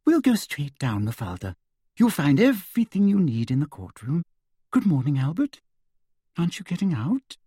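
sugary high-pitched official; sweet, simpering and high; Umbridge voice. A narrator's reading. Umbridge voice held.

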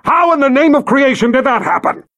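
high furious demand; angry yell. Acted. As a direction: bellow it at him. Karg yelling at officer.

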